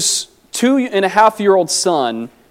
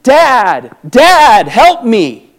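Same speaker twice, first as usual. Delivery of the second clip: put-on louder and higher, a small boy crying out Dad; retold child's cry for help, raised but not a full scream; 3 semitones higher, 5 dB louder, much slower than usual. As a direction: plead like a frightened toddler in pain. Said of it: "as he's screaming".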